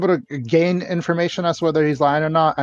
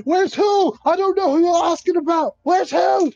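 much higher, shouting in panic; panicked denial. Acted. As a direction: yelp out a frantic denial. Scared suspect denies knowing.

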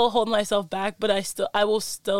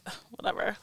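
bored dismissal; 9 dB quieter, faster than usual. Retold or imitated. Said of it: "I'm like".

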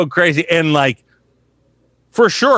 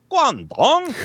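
GUANGDONG suddenly leaping high; two octaves higher; 11 semitones higher than usual. Retold or imitated. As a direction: break out of narration into a high, swooping GUANGDONG. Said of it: "it's two octaves higher".

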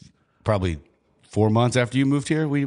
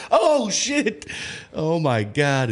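high-pitched, laughing mock alarm; acted-out stunned bystander reaction; retold shock; 8 semitones higher, much slower than usual. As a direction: cry out in amused horror, then ease down. Retells their shocked reaction.

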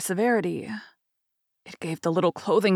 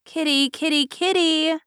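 calling the kitten; 9 semitones higher than usual. Acted. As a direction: sing out to a cat stuck up high.

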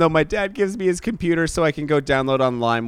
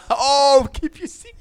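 high laughing echo; 10 semitones higher, slower than usual. Real, not acted. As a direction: repeat it through laughter. Echoes Frodo line laughing.

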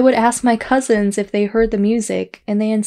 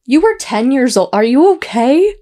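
higher and louder disbelief; shocked; 4 semitones higher, 6 dB louder than usual. Real, not acted. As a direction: react in real shock at the age. Shocked reaction to story.

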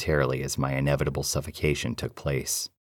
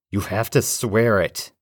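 insistent repeat; 6 semitones higher, 4 dB louder, slower than usual. Acted. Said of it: "I repeated".

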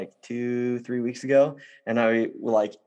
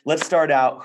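usual speaking voice

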